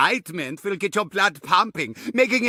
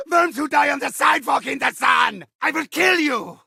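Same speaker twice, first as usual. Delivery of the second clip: higher, shouting villain rage, spitting every threat; furious ranting villain; 3 semitones higher than usual. Acted. Villain raging at players who keep surviving, furious shouting. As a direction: scream threats at the worms who won't die.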